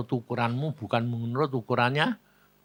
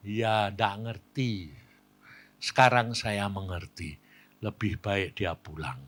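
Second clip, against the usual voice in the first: low, softer resigned reply; subdued acceptance; 5 semitones lower, 5 dB quieter, slower than usual. Retold or imitated. Wife accepting her husband's death, subdued. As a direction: let it sink lower and softer.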